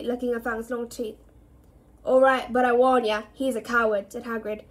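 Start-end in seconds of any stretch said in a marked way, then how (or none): 2.1-4.1 loud, matter-of-fact gamekeeper; gamekeeper's warning. A narrator's reading. Gamekeeper warns boy the dog's cowardly, loud. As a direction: warn him in a hearty raised voice.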